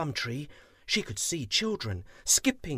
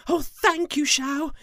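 high, loud, bursting with joy; thrilled and in a hurry to go; excited tiger, much higher and louder; joyful tiger; 13 semitones higher, 9 dB louder than usual. Acted.

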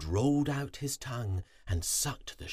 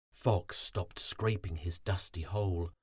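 ordinary delivery, nothing marked

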